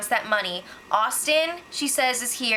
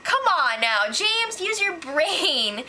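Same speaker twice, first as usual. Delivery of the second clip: slow, higher chiding of a dim friend; exasperated scolding; 6 semitones higher, slower than usual. Real, not acted. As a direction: spell it out slowly to him. Scolding James on screen.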